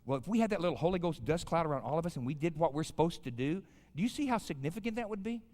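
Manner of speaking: usual speaking voice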